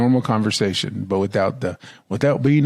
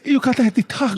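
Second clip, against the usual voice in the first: mock cartoon singer; 11 semitones higher than usual. Imitating cartoon mouse's song.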